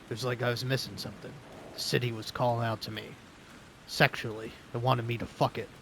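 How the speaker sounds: low gravelly noir narrator voice. Pretend. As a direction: brood in a cool gravelly voice. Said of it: "I just wanna talk in a cool, gravelly voice".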